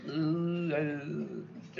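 high, soft wince of doubt; doubtful ooh. Retold or imitated. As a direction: recoil at the shrivelled plant. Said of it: "and just like".

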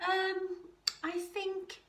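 high, halting reply; hesitant. A narrator's reading. Kevin the koala answering Wombat's invitation, hesitant.

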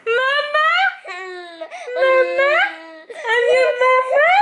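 high, thrilled coaxing. Real, not acted. Mother thrilled her baby just said mama, squealing high.

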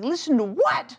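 high, loud mock-exasperated question; acted-out frantic comeback; exasperated retold retort. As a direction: fire back like a frazzled parent. Exasperated mother answers yelling son.

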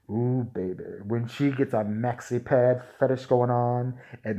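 low, slow seductive announcer; low crooning porn narrator; sleazy ad voiceover. Pretend. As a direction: purr it like a sleazy ad.

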